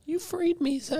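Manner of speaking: very high house-elf voice; squeaky Dobby impression. Freed house-elf thanking his master, high put-on voice.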